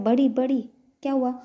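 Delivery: high, loud, urgent call. Acted. Ansh calls his big sister, urgent calling out.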